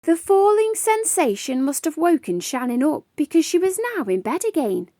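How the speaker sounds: ordinary delivery, nothing marked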